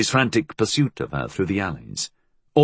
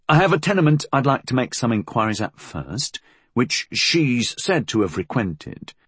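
higher-pitched subordinate, politely explaining; deferential inspector; 6 semitones higher than usual. Acted. Inspector explaining his plans to his superior, deferential.

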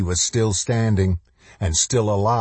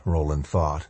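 usual speaking voice

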